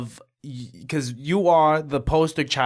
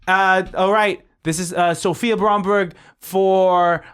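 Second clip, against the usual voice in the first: loud, raised announcing voice; director's slate call; 5 semitones higher, 5 dB louder, slower than usual. Acted. Director slating an actress's audition, announcing loudly. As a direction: announce the take to the set.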